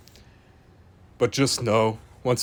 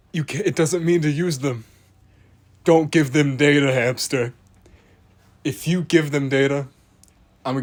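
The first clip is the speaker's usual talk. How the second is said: louder and higher, hammed-up lecture; mock scolding rant; 3 semitones higher, 5 dB louder than usual. Narrator mock-lecturing the hamster about the FBI, loud hammed-up scolding. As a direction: scold the hamster like a worried parent.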